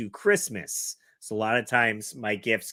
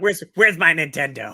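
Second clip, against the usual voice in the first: mock bratty demand; 7 semitones higher, 8 dB louder than usual. Listener mocks kid demand.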